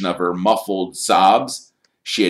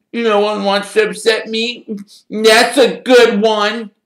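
high, wounded, mocking wail; howling; 6 dB louder than usual. A narrator's reading. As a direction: howl it back in hurt disbelief. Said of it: "howled Myrtle".

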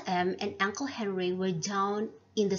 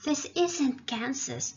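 little cry of surprise; 6 semitones higher than usual. A narrator's reading. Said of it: "Dorothy gave a little cry".

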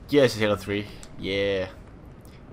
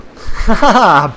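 loud, high burst of laughter; gloating laugh; 8 semitones higher, 9 dB louder than usual. Real, not acted. Player beating an enemy, gloating laugh. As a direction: laugh loudly in triumph.

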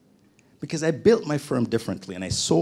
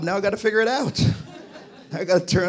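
laughing, higher and a little fast; 8 semitones higher, 3 dB louder, faster than usual. Real, not acted. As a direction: laugh through the worry. New job he can't yet do.

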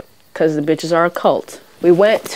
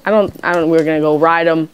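ordinary talk, nothing marked

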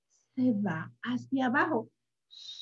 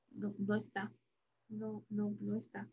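very soft, disappointed no; almost whisper it's not here; hushed; 4 semitones lower, 14 dB quieter than usual. Acted. Reading to child, looking for sheep.

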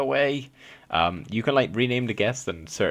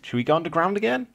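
excited; 9 semitones higher, 4 dB louder, faster than usual. Real, not acted. Excited dive underground.

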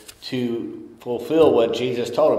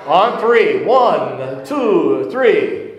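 count-in; 6 semitones higher, 7 dB louder, much slower than usual. Real, not acted. Pastor counts congregation in together.

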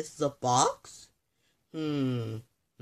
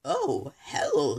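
usual speaking voice

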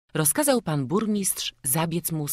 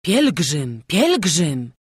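loud cry of recognition; crowd calling out; 7 dB louder, much slower than usual. A narrator's reading. Several townsfolk recognise the stranger, calling out loudly. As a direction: call it out, each word falling. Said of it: "zawołało kilkoro naraz".

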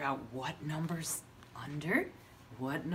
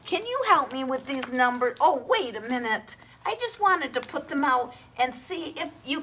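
put-on kids show host; 7 semitones higher, 6 dB louder than usual. Teacher shows mock surprise.

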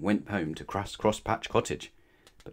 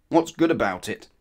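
louder, grumpy retort; 2 semitones higher, 6 dB louder than usual. A narrator's reading. Mister Grumpy answering Mister Happy's good morning, grumpy. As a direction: throw it back grumpily. Grumpy man's rude reply.

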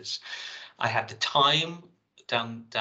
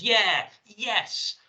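high, louder celebratory calls; excited retold cries; 10 semitones higher, 6 dB louder than usual. Reading how the rehearsal team reacted, excited imitated cries. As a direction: imitate a team crying out in triumph. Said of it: "simultaneously cry out".

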